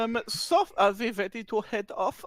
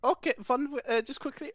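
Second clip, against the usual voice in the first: ordinary talk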